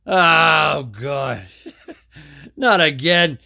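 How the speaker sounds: loud groan of "not again"; fed-up booming sigh of a cosmic being; exasperated shout. Retold or imitated. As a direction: bellow it like a weary giant god. Giant god sees Steve again.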